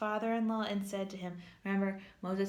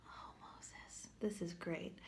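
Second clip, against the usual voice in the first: whispered wry aside; 4 semitones lower, 10 dB quieter, slower than usual. Real, not acted. Teacher whispering a sarcastic aside about Moses. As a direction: murmur it under your breath.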